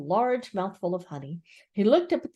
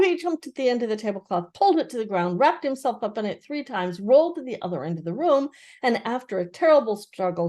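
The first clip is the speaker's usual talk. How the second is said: ordinary delivery, nothing marked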